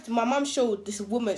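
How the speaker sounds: ordinary delivery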